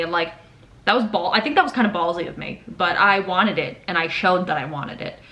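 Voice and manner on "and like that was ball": ordinary delivery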